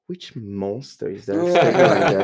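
incredulous question through laughter; laughing mock horror. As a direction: laugh as you ask who is talking. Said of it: "was like".

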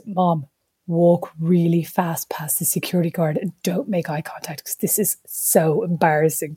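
urgent embarrassed whisper. Retold or imitated. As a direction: whisper so nobody hears.

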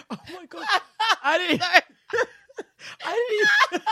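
high, giggling through words; real helpless laughter; laughing. Real, not acted. Can't stop laughing at mishearing.

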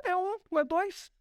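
high, scornful voice of the small guy sizing up the giant; scoffing little guy. Retold or imitated. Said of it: "Ele viu o gigantão lá e falou".

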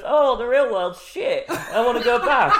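high, loud, laughing whine; mock mermaid. Mocking the mermaid's regret, high and laughing. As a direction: whine about the real world while laughing. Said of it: "she goes".

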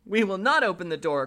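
put-on high kids' refusal; crying out. A narrator's reading. Kids refusing the wolf, cried out high. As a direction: cry it out a pitch up. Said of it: "cried they".

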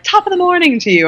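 high, hammed-up Irish lilt; mock Irish greeting. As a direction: toss off a cheesy Irish greeting, pitched high. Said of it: "it's like the equivalent of".

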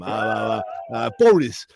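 low, bumbling posh politician voice; blustering prime minister, stammering; Boris Johnson impression. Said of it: "how did Boris become Prime Minister?".

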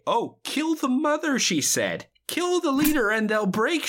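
high mocking voice; hammed-up high Weave. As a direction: squeak out the order. Retelling Weave's line.

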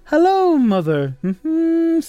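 high squeaky "Hello" sliding down into "mother", then a high "Mm-hmm"; mock movie line. Presenter quoting a movie line at the awards, high-pitched and camp.

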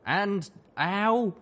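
high, pained little wince. Acted. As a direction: wince at the noise. Calamity pained aside.

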